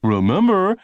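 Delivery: high, loud punchy opener; announcer voice. A narrator's reading. Announcer opening the pitch, loud and high. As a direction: hit it like a pitchman. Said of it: "the announcer would say".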